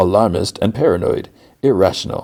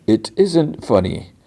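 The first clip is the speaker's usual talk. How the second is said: slow, higher-pitched prim objection; stuffy; 7 semitones higher, slower than usual. Retold or imitated. Stuffy coworker objects to the others laughing, mock-prim and slow. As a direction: imitate a stuffy man refusing the joke. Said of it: "he's so stuffy".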